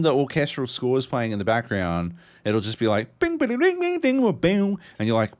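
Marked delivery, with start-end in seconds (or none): 3.2-4.8 high sung-out mock guitar plinks, falling away; imitated country riff. Mocking the game's looping country music, high sung plinks. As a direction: plink it out like a tinny banjo riff. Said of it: "the forgotten riff to "I'd Love to Have a Beer with Duncan".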